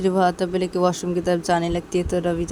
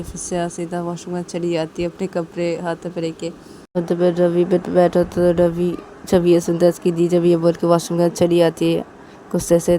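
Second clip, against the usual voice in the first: usual speaking voice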